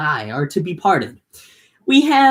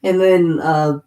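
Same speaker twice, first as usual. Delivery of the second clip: ordinary talk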